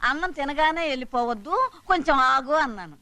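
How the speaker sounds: usual speaking voice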